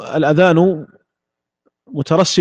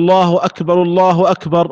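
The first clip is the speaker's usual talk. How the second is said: الله أكبر twice, linked as "akbaru-llahu akbar" with no pause; joined in one breath. Shown as a demonstration. Teacher showing the muezzin's option, two takbirs joined. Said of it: "القرن بين التكبيرتين بنفس واحد".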